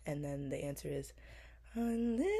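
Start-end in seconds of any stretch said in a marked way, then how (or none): 1.7-2.3 squeaky high crack on the word. Real, not acted. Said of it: "Always cracked a little".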